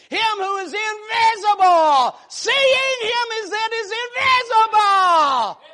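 shouted, high sermon climax; whooping preacher crescendo; preaching at full force. Real, not acted.

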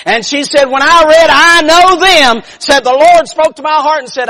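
high, loud, urgent testimony; full-force preaching. Real, not acted. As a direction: drive the revelation home forcefully.

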